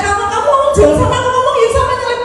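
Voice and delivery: high, fast scolding imitation; mock angry. Husband retorts to wife about the car, mock angry. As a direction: imitate an annoyed, hurried retort. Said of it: "dia marah, katanya".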